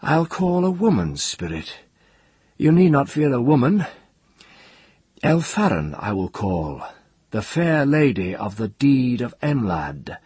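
high, lofty, gently mocking voice; gentle mockery. A narrator's reading. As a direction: taunt grandly, voice lifted high. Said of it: "Ged said with the same gentle mockery".

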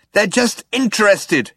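high, exasperated shout. A narrator's reading. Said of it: "shouted Zaphod".

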